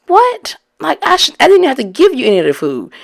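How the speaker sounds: shocked, can't believe what she's hearing; stunned disbelief. Retold or imitated. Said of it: "I'm thinking to myself".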